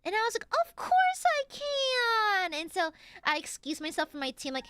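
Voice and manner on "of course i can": much higher and louder gushing agreement; eager yes. Acted.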